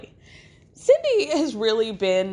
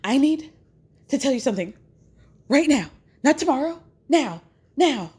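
theatrical urgency; 6 semitones higher, faster than usual. Retold or imitated. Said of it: "back on stage at Northwestern".

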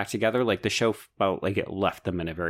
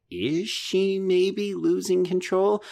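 slow, higher worried wondering; suspenseful wondering; 10 semitones higher, slower than usual. Retold or imitated. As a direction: voice the thought slowly with suspense. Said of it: "And I thought".

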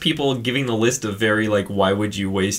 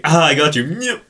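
high, playful "got you" with a squeak; mock gotcha; 6 semitones higher, 6 dB louder than usual. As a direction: tease high and end on a comic noise. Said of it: "just, like, this little".

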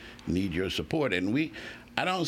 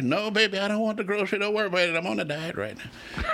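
playful quote voice; 11 semitones higher, faster than usual. Retold or imitated. Voicing himself declining groceries.

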